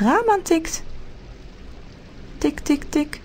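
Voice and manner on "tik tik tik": quiet, high tik tik tik; soft tapping. A narrator's reading. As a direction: tap it softly like something at the window. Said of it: "hoort Rosa getik".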